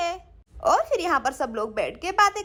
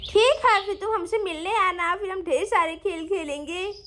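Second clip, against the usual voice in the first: put-on lisping, childlike pleading voice; pleading little kids. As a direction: play small children begging to stay together. (तुतलाते, ज़िद करते बच्चों की आवाज़)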